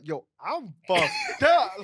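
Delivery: slow, loud, breaking into laughter; laughing confession. Retold or imitated. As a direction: confess it while cracking up, slowly. Retelling being very drunk.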